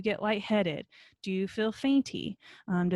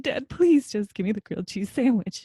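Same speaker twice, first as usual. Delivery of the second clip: put-on pleading child; 7 dB louder than usual. Five-year-old begging her dad.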